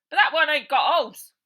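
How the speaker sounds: high, loud lackey protest; high, loud and anxious; worried henchman. Acted. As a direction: fret to the boss.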